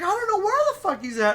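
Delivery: high, fast mock protest; hammed-up rapid high-pitched denial; imitating Quincy. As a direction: blurt it out in a put-on high voice.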